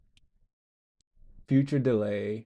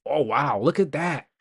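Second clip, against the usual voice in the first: ordinary talk, nothing marked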